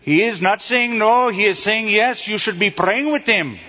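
high-pitched imitated Indian accent. Indian brother correcting the preacher's wife, imitated Indian accent.

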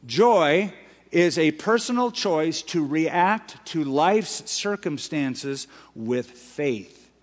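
slow, deliberate restatement; slow repetition. Shown as a demonstration. Preacher repeating his definition slowly. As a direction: spell it out slowly for them. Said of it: "Again, I say".

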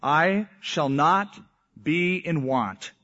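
"I shall not be in want" drawn out slowly, word by word; slow and solemn. Shown as a demonstration. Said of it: "He says in the next phrase".

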